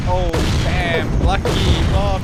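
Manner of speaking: shouting out in surprise; a loud startled call; loud exclamation. Real, not acted. Exclaims at game event.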